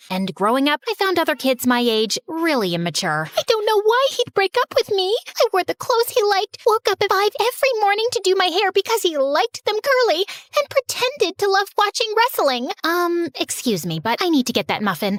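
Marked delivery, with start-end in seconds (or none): 3.4-12.7 put-on whiny heartbroken girl. Crying girl voiced higher.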